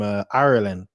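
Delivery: ordinary talk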